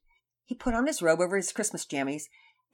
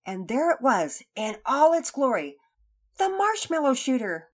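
louder, higher grand announcement; excited reveal; 7 semitones higher, 8 dB louder than usual. A narrator's reading. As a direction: build it up like a big reveal. Narrator builds up the reveal.

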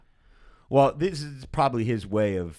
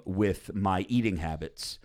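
usual speaking voice

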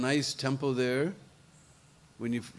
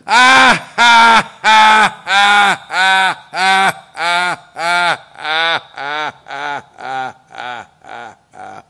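a giant demon laughing at a puny challenger, dying away; demon's mocking laugh; 12 dB louder, much slower than usual. Demon laughs at Shatrughan, booming then fading. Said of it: "And Lavanasur began to laugh".

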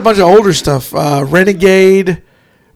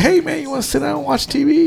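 laid-back stoner; 7 semitones higher, 6 dB quieter than usual. Retold or imitated. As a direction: imitate a mellow stoner offering a seat. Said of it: "Brad Pitt in True Romance. He's like".

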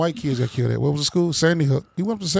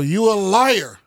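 retold yell; 5 semitones higher, slower than usual. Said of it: "the man yelled out".

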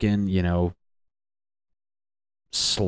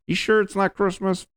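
squeaky disbelieving question, pitched way up; high mock voice; 13 semitones higher than usual. Relative doubting it's spring, high mock question. Voicing the family.

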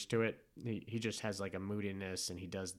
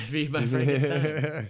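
laughing; 4 semitones higher, 10 dB louder, slower than usual. Real, not acted. Echoing [name] song title.

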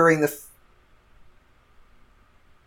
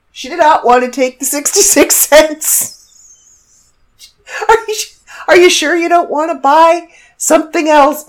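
imitating cashier; 9 semitones higher, 6 dB louder than usual. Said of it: "And she's like".

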